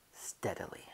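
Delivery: steadily repeated in a whisper; whispered echo. Acted. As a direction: whisper steadily as you inch the door. Repeats steadily while creeping.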